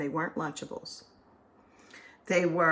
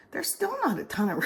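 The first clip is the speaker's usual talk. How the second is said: ordinary talk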